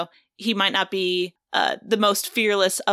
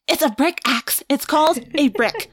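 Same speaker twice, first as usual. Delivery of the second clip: a bit higher, exasperated; Rachel voice; 6 semitones higher than usual. Acted. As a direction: correct him with impatience. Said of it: "in character voice".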